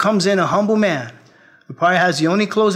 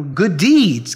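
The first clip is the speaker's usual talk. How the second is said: ordinary delivery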